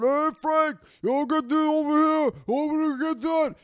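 imitating the roofers yelling to each other; mock roofer yelling. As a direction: call out like a roofer across the roof. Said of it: "I hear them all up there fucking yelling".

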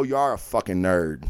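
ordinary talk, nothing marked

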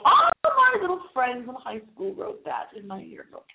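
ordinary talk, nothing marked

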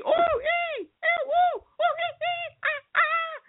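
very high shrieking monkey calls; monkey screams. Acted. Girl mimicking monkeys screaming at her, very high. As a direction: shriek like a tree full of monkeys. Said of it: "screaming at me".

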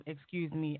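usual speaking voice

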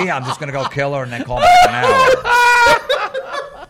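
high, loud, laughing yell; laughing shout. Retold or imitated. To the telemarketer, shrieking with laughter. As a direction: crack up while yelling it.

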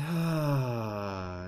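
long audible exhale; big sigh. Shown as a demonstration. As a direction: let it all out in a sigh. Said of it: "nice big sigh".

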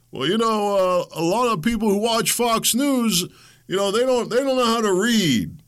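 playing the smooth, professorial politician mocking Fox viewers; Obama impression. Host mimicking the president belittling Fox News viewers in a high, lofty voice. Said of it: "little dose of, uh, Brother Obama".

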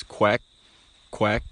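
short quacks cut off at the end; clipped quack. Shown as a demonstration. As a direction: stop each quack sharply.

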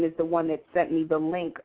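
ordinary talk

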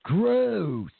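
a hint of a disgusted critic saying gross; mock disgusted reading. Host reads listener Paul's complaint, lightly mocking disgust. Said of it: "I'm sure that's how he said it".

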